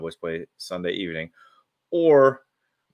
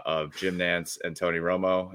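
normal talking voice

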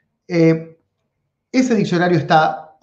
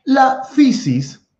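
La fisis very slow and much higher; emphatic; 12 semitones higher, 4 dB louder, much slower than usual. Shown as a demonstration. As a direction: announce La fisis slowly, pitched up. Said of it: "emphatic term introduction".

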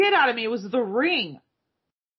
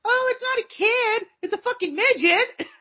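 shocked realization; 10 semitones higher than usual. Retold or imitated. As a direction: gasp it out in disbelief. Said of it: "'cause I was like".

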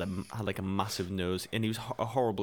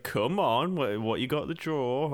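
higher, louder sing-song goading; mock-coaxing teacher; 5 semitones higher, 4 dB louder, slower than usual. Teacher demanding to see the drawing, mock-coaxing. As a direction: imitate a teacher egging on a pupil, words stretched. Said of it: "he played the whole thing of".

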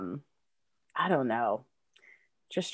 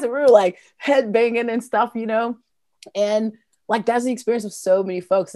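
excited; 7 semitones higher, 8 dB louder than usual. Real, not acted. Excited recounting teen headbanging.

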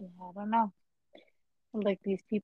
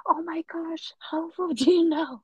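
high whispered oh my gosh, then louder laughing how; breathy, high alarm breaking into laughter; mock shocked gasp; 9 semitones higher, 6 dB louder than usual. Retelling scammer caught by hacker, high startled whisper.

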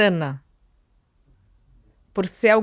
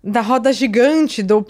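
ordinary talk

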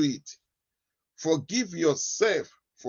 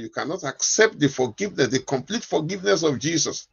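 usual speaking voice

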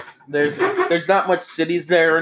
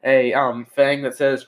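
normal talking voice

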